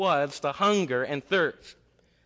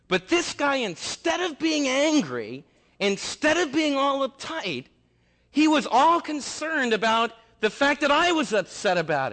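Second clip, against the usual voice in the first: much higher and louder, rising fervour; excited build; 10 semitones higher, 6 dB louder than usual. Real, not acted. Preacher stirring the congregation, higher and louder.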